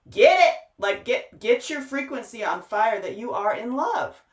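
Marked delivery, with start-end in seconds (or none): 0.1-0.5 sudden loud high shout; shouted command. Real, not acted. Motivational speaker yelling at her followers, loud. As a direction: bark it out to fire them up. Urging listeners to get it.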